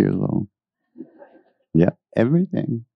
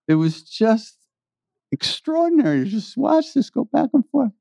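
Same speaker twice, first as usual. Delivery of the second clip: high, marvelling exclamation; thrilled recollection of the scene; delighted; 13 semitones higher than usual. Real, not acted. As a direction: marvel at it, voice leaping up.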